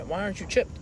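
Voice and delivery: ordinary talk, nothing marked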